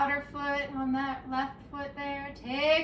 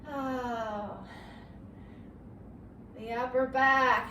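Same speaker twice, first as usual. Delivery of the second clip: normal talking voice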